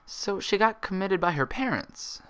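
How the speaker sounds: slow troubled remark. Acted. Twilight mulling it over with Applejack, slow thoughtful remark. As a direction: weigh each word slowly. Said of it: "she finally said".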